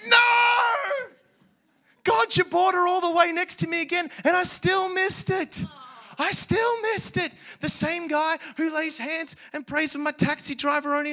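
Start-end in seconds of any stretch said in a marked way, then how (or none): 0.1-7.3 crying shout, much higher and louder; anguished cry. Retold or imitated. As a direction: wail it out in despair at yourself. Retells missing chance, cries out.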